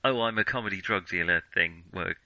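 ordinary delivery

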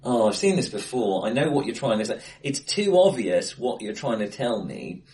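ordinary delivery

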